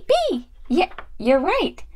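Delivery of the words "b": high little doll voice; pupil doll. Pretend. Doll answering the teacher, high and eager. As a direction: pipe up the answer in a toy voice. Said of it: "What's the next letter?".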